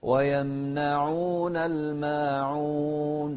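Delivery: slow, sung recitation; slow sung Quran recitation; melodic Quran recitation. Shown as a demonstration. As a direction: chant it slowly and melodically.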